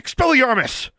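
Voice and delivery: high, loud spell cry; spell shout. A narrator's reading. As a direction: fire off the spell. Casting a spell in combat.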